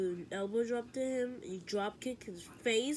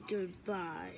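ordinary talk

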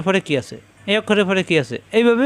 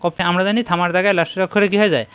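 ordinary talk

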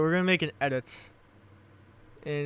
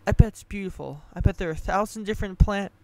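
put-on higher, eager girl's voice, speeding up; Brooke's voice; 4 semitones higher, faster than usual. A narrator's reading. Brooke dreaming aloud just before the shot, higher. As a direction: gush on, higher and faster.

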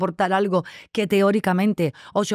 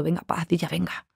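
softer, coaxing herself to stop crying; soft self-pep-talk; 5 dB quieter than usual. Retold or imitated. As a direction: talk yourself down, quietly.

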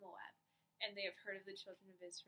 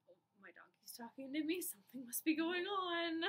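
rising to a loud, much higher amazed cry; mock astonishment; 8 semitones higher, 9 dB louder than usual. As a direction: play up the shock that the donkey talks. Imagined astonished reaction to donkey.

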